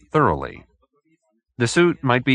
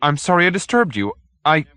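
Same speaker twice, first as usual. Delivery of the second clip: high, eager fluttering agreement; flustered little man; 8 semitones higher, 3 dB louder than usual. Acted. Hoag agreeing to the detective, high and flustered. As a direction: hurry to agree, a bit flustered.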